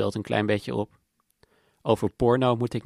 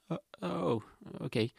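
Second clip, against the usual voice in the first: a faltering, softer "oh, okay"; startled stammer; 6 semitones higher, 7 dB quieter than usual. Acted. Girlfriend startled by someone passing, faltering softly. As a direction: caught off guard, trailing into a quiet okay.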